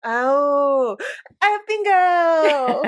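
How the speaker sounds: loud, slow triumphant cry; excited shout. Real, not acted. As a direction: celebrate loudly. Winning bingo in game.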